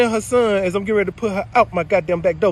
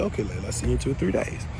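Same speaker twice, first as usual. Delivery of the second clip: quiet quoted reply; 11 semitones lower, 11 dB quieter than usual. Retold or imitated. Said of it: "I said".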